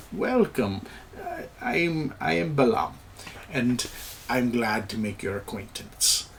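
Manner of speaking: put-on imperfect Kalimshan accent; halting, softly put-on Kalimshan accent; Balam. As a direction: greet someone in a gentle fantasy Kalimshan accent. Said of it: "Balam talks with the accent, and he goes".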